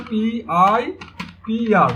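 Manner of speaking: slow letter-by-letter spelling; spelled out. Shown as a demonstration. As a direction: stretch each letter out separately. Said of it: "উচ্চারণ".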